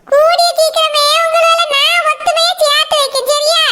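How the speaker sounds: a grandmother calming the weeping bride; soothing old grandmother. Acted. Grandmother comforts the couple.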